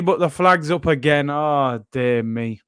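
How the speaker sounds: ordinary delivery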